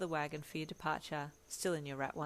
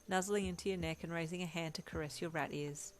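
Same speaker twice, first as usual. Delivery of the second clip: usual speaking voice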